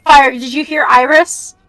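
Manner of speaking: normal talking voice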